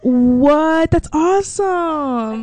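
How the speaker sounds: stretched-out amazed praise; surprised delight. Real, not acted. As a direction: react to a friend's big news. Reacting to intern news.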